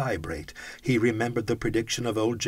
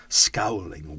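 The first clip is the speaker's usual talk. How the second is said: ordinary delivery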